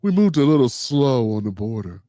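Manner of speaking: low, put-on mocking politician voice; mocking Hakeem Jeffries. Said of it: "His other lie is".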